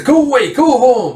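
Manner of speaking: high, loud heckling shout; mob jeering. Retold or imitated. Townspeople mob shouts at riders, hostile. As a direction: act out an angry crowd's high yell.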